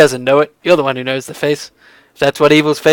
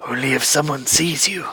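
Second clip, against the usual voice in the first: lowered, furtive voice; sneakish; 9 dB quieter than usual. Acted. Dorhan warns guests about sneaking into the holes, furtive. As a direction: say it softly, as if someone may overhear. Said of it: "looks sneakishly around and says".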